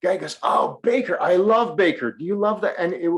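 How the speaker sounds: ordinary talk, nothing marked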